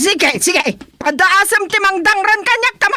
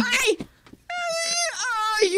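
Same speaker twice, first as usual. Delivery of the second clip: pained cry; 5 semitones higher, 9 dB quieter than usual. Acted. Hit during a fight, cries out.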